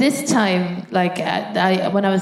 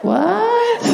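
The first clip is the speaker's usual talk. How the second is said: much higher incredulous what; shocked squeak; 13 semitones higher than usual. Retold or imitated. Patient hearing the speculum broke, shocked and high. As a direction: squeak it in disbelief.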